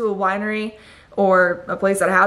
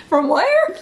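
surprised; 10 semitones higher, 3 dB louder than usual. Real, not acted. Mock surprise at Saint Louis.

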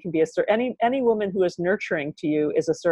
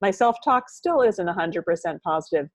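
ordinary delivery

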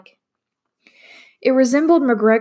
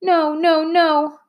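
high, panicked whimper; 8 semitones higher, much slower than usual. A narrator's reading. Maria whimpers in panic at the clone. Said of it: "whimpered Maria".

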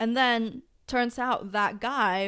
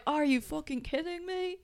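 put-on Southern accent; 6 semitones higher, 3 dB quieter than usual. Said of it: "I don't know how to do accents".

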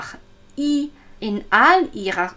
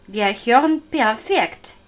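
ordinary talk

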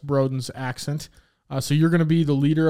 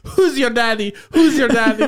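high, loud goofy Pistachio voice; Pistachio impression; 9 semitones higher, 7 dB louder than usual. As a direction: quote Pistachio's catchphrase, hammed-up. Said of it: "Pistachio Disguisey".

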